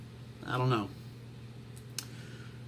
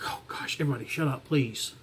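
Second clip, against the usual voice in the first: a breathy exasperated whisper, much softer; 7 dB quieter than usual. Retold or imitated. Vlogger retelling how he hushes blamers, whispered exasperation. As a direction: wants to hush everyone, quietly fed up. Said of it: "I'm like".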